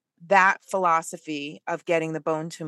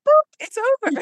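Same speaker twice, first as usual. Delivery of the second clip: high, loud, playful doctor impression; mock meme doctor; 6 dB louder than usual. Doctor to a crying baby in a meme, mock high chirp. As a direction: pop it out in a high cartoonish voice. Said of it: "the doctor is like".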